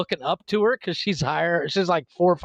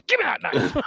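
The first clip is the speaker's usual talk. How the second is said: laughing demand; 11 semitones higher than usual. Retold or imitated. Laughing dad demanding the knife.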